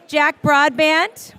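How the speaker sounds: slow, loud roll-call across a crowd. Real, not acted. Host calling latecomers to take their seats, calling out. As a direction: call the name out loud and drawn-out. Calling names to seat people.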